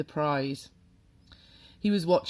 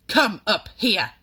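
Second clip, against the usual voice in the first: loud, higher, slow command; shouted order; 5 semitones higher, 9 dB louder than usual. A narrator's reading. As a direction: shout the boy up to the stage. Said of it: "the Trunchbull shouted".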